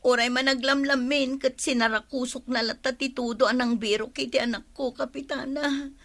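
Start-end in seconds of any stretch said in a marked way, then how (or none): none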